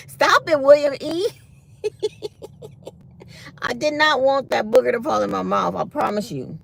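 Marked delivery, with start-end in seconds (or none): 0.1-1.3 loud, high-pitched scolding call. Real, not acted. Calls out to someone, scolding. 1.8-2.9 high, breathy string of giggles. Real, not acted. Laughs after scolding William E.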